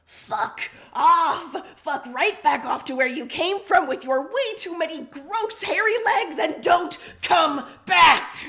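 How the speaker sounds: high, loud, furious shout at the spiders; raging shout rising to a slow, bellowed last line; furious yelling. Acted. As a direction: scream them out of the room, broom swinging. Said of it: "furiously flailing a broom around and swearing".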